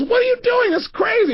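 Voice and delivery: high-pitched mock squeal of protest; amused disbelief. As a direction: imitate a woman protesting, incredulous. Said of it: "and she started laughing".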